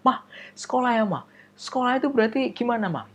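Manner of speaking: put-on eager child. Child asking mother.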